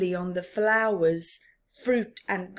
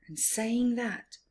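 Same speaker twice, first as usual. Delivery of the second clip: usual speaking voice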